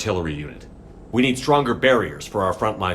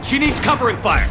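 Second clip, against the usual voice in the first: loud call over gunfire, pitched high; urgent shout; 3 dB louder than usual. Acted. Soldier to Shepard over gunfire, urgent shout. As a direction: yell it across the battlefield. Firefight, calling for cover.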